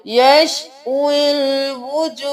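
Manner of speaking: यशविल वुजूह slowly, waw run straight into laam; यशविल joined, no alif. Shown as a demonstration. Teacher slowly demonstrates the joining for students. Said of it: "वाव को लाम के साथ मिलाएंगे".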